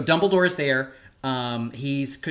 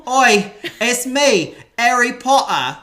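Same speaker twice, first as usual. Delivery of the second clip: loud, slow, high-pitched British boy impression; Harry Potter; 10 semitones higher, 10 dB louder, slower than usual. Podcaster shows off an impersonation for cohost, loud hammed-up British. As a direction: shout it slowly in a hammy British accent. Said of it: "have you heard my Harry Potter impersonation".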